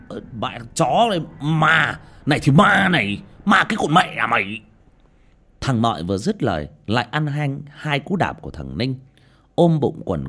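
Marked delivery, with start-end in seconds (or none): 0.1-4.6 angry cursing. A narrator's reading. Son beats and curses the servant.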